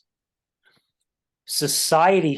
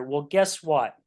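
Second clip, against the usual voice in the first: ordinary talk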